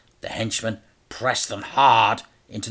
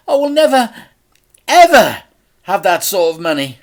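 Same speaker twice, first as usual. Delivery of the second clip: much higher, loud despair; despairing father; 10 semitones higher, 11 dB louder than usual. A narrator's reading. Dad despairs at the huge debt. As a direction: cry out hopelessly.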